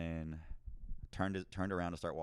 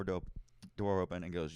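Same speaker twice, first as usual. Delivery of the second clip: ordinary talk, nothing marked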